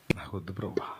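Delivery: hushed, breathy whisper to the Lord; barely voiced, turning down riches; whispered reply. Believer whispering to the Lord, refusing riches.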